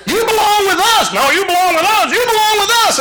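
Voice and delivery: high, loud bickering back and forth; hammed-up church folks arguing who owns whom; mock squabble. Grown folks squabbling over members.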